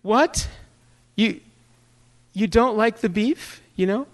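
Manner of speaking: high, mock-wounded mom voice; tearful guilt-tripping mother. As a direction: imitate a hurt mother welling up. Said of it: "And tears are welling up".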